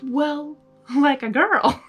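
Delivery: plain reading voice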